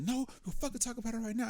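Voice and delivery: mimicking her, pitched well up; insistent girlfriend. Man imitating his girlfriend in an argument, insistent. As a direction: imitate a partner who will not drop it.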